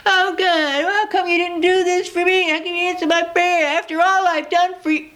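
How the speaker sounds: high self-pitying whine to God; whiny complaint. Retold or imitated. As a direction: complain like a spoiled child who feels owed. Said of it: "I was complaining".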